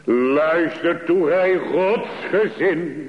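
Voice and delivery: preacher rousing God's people to listen; impassioned preaching. Acted. Climax of sermon, calling the congregation.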